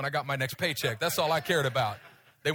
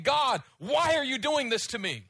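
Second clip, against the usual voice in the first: retold tearful plea; 7 semitones higher than usual. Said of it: "weeping and crying and saying".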